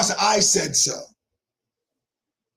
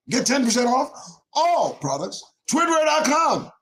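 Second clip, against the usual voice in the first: sponsor shout; 5 semitones higher than usual. Acted. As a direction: sell it like a TV pitchman yelling the brand. Hyped sponsor plug shout.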